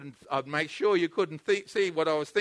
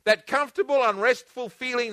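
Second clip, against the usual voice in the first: normal talking voice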